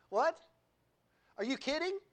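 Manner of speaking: rising, half-laughing disbelief; mock incredulity. Pastor playing a doubting churchgoer, mock incredulous. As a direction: play the offended listener.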